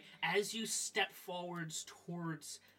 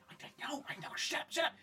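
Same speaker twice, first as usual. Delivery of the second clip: hushed creature voice; 10 semitones higher than usual. Acted. Second creature answering hushed.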